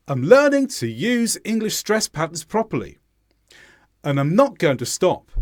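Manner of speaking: stressed words punched, "to" and the endings of "patterns properly" reduced to uh; schwa weak forms. Shown as a demonstration. Teacher showing how the sentence sounds, small words weakened to schwa. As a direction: lean on "learning", "use", "not", "stop", weakening what lies between. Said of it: "introduce this schwa sound".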